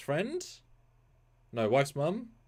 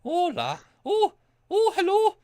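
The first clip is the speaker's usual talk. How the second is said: much higher, louder hammed-up Scottish puppet; 10 dB louder than usual. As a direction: greet her like a squeaky Scottish puppet. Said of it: "I'm going Scottish".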